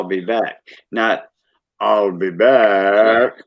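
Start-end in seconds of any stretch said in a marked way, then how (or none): 1.8-3.4 slow, heavy Austrian accent. Shown as a demonstration. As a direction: drag it out like Arnold in Austrian. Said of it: "He doesn't have the heavy Au-, Austrian accent".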